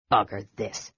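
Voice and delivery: low, soft grumble; muttered. A narrator's reading. Dwarf fed up with waiting, muttered low. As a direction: mutter it under the breath. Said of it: "muttered Flint".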